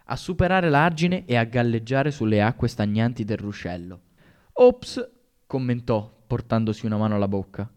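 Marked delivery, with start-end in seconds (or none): 4.5-5.1 "Ops" popped up high and loud in fake surprise; mock oops. A narrator's reading. Bully pretending he dropped the cap, fake surprise. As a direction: act innocent, overdone.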